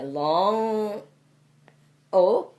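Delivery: long, drawn-out "long", then a loud round O; the O held round and strong. Shown as a demonstration. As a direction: stretch out long O with rounded lips. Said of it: "it should be pronounced like".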